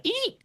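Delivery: very high squeaky eat; printer squeal. Retold or imitated. Cook mimicking the order printer, a tiny high squeal. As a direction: squeal it like a machine beeping.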